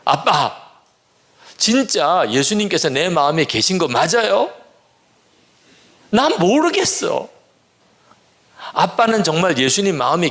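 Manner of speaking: high-pitched protest, no real volume; mock the daughter's tearful outburst at her father; pleading, high-pitched challenge, acted out; imitated yelling. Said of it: "소리, 소리 질러요".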